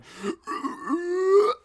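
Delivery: high, drawn-out monster noise; imitated demon screech. Goat demon looming over them from the roof, screeching. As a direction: mimic a goat demon's rising cry. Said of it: "then just going".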